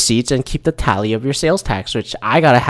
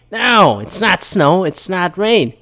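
high, drawn-out imitation of a yelling woman; mock yelling; 8 semitones higher, slower than usual. Lady correcting him on freezing rain, slow mock yelling. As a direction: stretch it out in exasperated mock protest. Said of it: "She started yelling".

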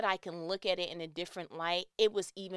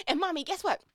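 chatty little daughter; 9 semitones higher, 5 dB louder, faster than usual. Retold or imitated. Talkative child voiced to mom.

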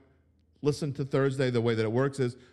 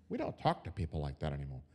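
much lower and softer mock-prim tone; mock whisper-soft parody; mocking prissy voice; 10 semitones lower, 10 dB quieter, faster than usual. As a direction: sneer at soft talk in a hushed, precious voice. Mocking soft-coach attitude.

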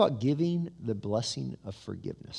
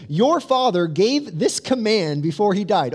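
mock solemn message; 6 semitones higher, 7 dB louder than usual. Brothers faking dad's message.

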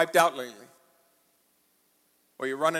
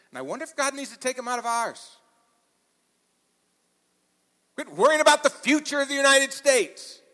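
higher, louder pulpit exhortation; full-force preaching; 9 semitones higher, 6 dB louder than usual. Acted. Pastor urging the congregation to quit worrying, loud preaching. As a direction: drive it home like a revival preacher.

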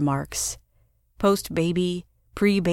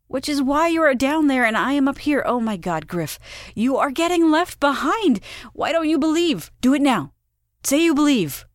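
panicked pleading; 8 semitones higher than usual. A narrator's reading. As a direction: beg him to believe before she floats off. Wife panicking while floating away.

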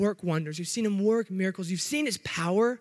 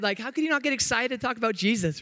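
ordinary talk, nothing marked

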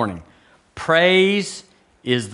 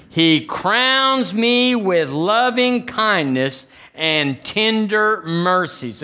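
slow, drawn-out, higher proclamation; led declaration; 6 semitones higher, much slower than usual. Real, not acted. Preacher leading the church in first-person scripture, slow declaration. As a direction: stretch each word for the congregation to repeat.

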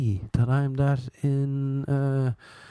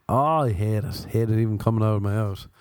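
usual speaking voice

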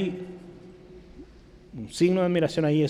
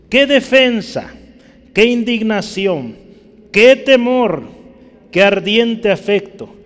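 full-force preaching; 6 semitones higher, 7 dB louder than usual. Acted. Preacher calling out each result to the congregation, preaching hard. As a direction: thunder out every question to the pews.

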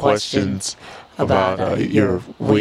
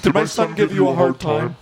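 high put-on mom, asking; squeaky pretend mother; 9 semitones higher than usual. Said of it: "here's my mom now".

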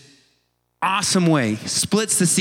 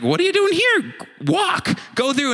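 much higher, exasperated God voice; impatient God; 13 semitones higher than usual. Retold or imitated. God telling Moses to move, high and exasperated. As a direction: hurry Moses along like a fed-up father.

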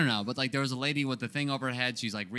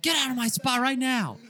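high-pitched imitated order to leave; retold angry yell; 10 semitones higher than usual. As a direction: play the owner kicking someone out. Retold owner throwing him out.

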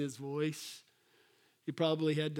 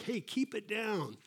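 higher, softer telling-off; mock shushing; 4 semitones higher, 5 dB quieter than usual. Apostles hushing the beggar, high soft mock rebuke. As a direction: imitate someone hushing a noisy beggar. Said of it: "the apostles and the people around Jesus".

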